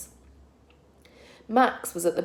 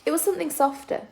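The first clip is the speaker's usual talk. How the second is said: usual speaking voice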